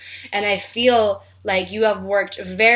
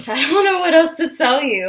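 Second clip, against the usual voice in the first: higher and louder, through a laugh; 7 semitones higher, 6 dB louder than usual. Real, not acted. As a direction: laugh helplessly at the end. Laughing, overwhelmed by emotion.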